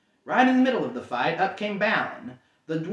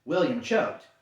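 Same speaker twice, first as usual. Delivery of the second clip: plain reading voice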